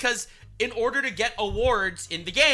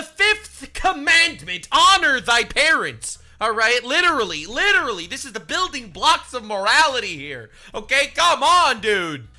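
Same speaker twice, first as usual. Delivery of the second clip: emphatic preaching; 4 semitones higher, 8 dB louder than usual. Real, not acted. Preaching outrage about commandment.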